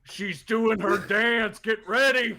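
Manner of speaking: rising, hammed-up shout to the flock; rallying call. Bird rallying the flock as Spoons starts her act, rallying call.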